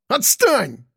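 high, exasperated yelp; almost squeal as you push them off; near-squeal. A narrator's reading. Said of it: "почти что взвизгнул Ананас".

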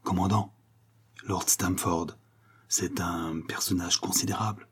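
soft, near-whispered confidential caution; murmured. A narrator's reading. Commissioner cautioning captain about a suspect, murmured. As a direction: murmur it discreetly, with pauses.

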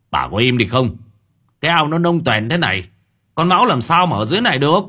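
husband shouting his wife down; angry scolding. Acted.

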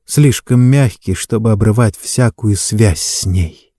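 ordinary delivery

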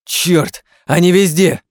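loud, high panicked warning; alarmed shout. Acted. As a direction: yell it, surrounded. Surrounded by creatures.

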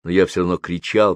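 ordinary delivery, nothing marked

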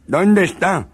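high loud yell; furious demand. Acted. Jealous husband threatening his wife, furious. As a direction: demand it with menace.

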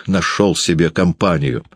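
ordinary delivery

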